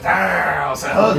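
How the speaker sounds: loud, high mock holler of a teammate; imitated taunt. Player mimics teammate's shout from behind him, loud mocking holler. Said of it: "and he goes".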